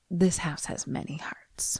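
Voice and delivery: soft, unvoiced mystic whisper; eerie whisper. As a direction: whisper it like a spooky medium. Medium's eerie pronouncement.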